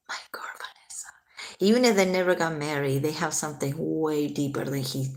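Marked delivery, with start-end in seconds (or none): 0.1-1.1 barely voiced, very soft murmur; whispered quote. Echoing his words about Vanessa, in a hushed whisper. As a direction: whisper it as a fond imitation. Re-quoting his fond phrase.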